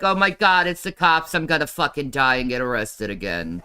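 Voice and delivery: loud panicked gasp of fear; mock freaked out panic. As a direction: play it freaked out by a door knock. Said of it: "I'm like".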